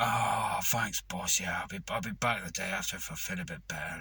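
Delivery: whispered weakly, playing ill; feeble sick whisper. Faking a sick man thanking his boss, in a feeble whisper. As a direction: breathe it like you can barely talk.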